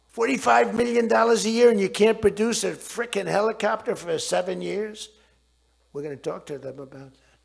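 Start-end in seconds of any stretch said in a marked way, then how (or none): 0.1-5.1 loud, scornful rant; indignant shout. Retold or imitated. Berating defense contractors, louder and indignant. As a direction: shout the figures in disbelief.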